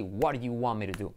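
the teacher's fluent model of "What do you want me to do"; corrected natural pronunciation. Shown as a demonstration. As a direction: blur "do you" and "want me to" into one flow. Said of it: "tienes que hacerlo así".

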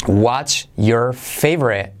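word by word, very slow; slow careful model. Shown as a demonstration. Teacher introducing the question to learners, slow and separated. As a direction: separate "What's", "your", "favorite".

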